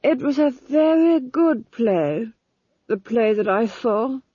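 slow, dreamy duke; dreamily. A narrator's reading. Duke recalling a play to the Duchess, slow and dreamy. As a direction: drift off remembering the play. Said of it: "said Felmet dreamily".

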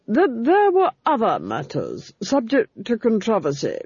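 coldly steer the talk to the cover-up; menacing duchess. A narrator's reading. Duchess pressing the Fool on the record, menacing.

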